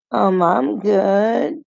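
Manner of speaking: high, drawn-out mock reply; put-on sing-song politeness; imitated coy date. Imitating the date's coy answer.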